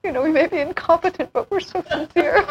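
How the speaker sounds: high, laughing mock despair; mock sincere. Joking about things going wrong in Ananda, high and laughing. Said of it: "I would sometimes say".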